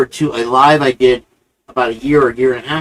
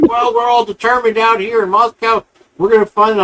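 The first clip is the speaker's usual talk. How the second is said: higher, earnest can-do voice; lightly lampoon the eager investigator; a hint of a self-important TV sleuth; mock investigator; 8 semitones higher than usual. Imitating the investigator answering.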